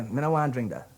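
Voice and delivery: Guyanese Creole demo, clipped to "Me no want drink that"; casual Guyanese country speech. Shown as a demonstration. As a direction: say it like the sugar belt folk of Guyana. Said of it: "You say".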